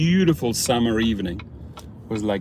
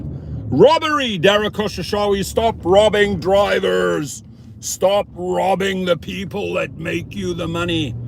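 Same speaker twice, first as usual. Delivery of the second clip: angry rant; 6 semitones higher than usual. Real, not acted. Ranting at rideshare CEO.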